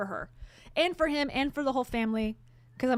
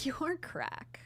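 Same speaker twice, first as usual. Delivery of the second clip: breathy repeat under her breath; whisper; 4 semitones higher, 10 dB quieter than usual. Pretend. Repeating the jab to herself in a whisper. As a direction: whisper it back like a secret aside. Mock retort, then whispered.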